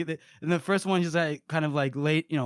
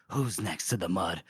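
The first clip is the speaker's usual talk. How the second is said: much lower, softer and menacing; low menacing killer; 10 semitones lower, 6 dB quieter than usual. Retold or imitated. The Bloody Nine dares the circle of men, low and menacing. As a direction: growl the challenge, low and quiet. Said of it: "he says".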